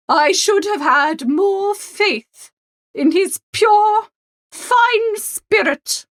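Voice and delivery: high, slow, rising into a lofty apology; high, slowed, grandly repentant aristocrat; contrite Lady Morvern. Acted. Lady Morvern voiced by narrator.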